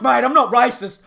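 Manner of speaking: ordinary delivery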